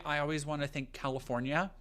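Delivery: normal talking voice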